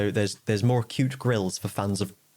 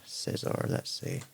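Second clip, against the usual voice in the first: whisper; 8 dB quieter, slower than usual. Real, not acted. Muttering to self while checking.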